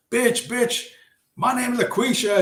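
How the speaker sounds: high-pitched, sassy fighter; hammed-up high-voiced outrage; mock combative customer. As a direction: play her squabbling at the counter in a high voice. Retold fight at the counter.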